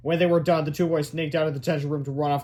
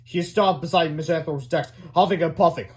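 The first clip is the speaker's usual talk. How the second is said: plain reading voice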